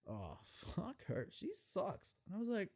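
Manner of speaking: usual speaking voice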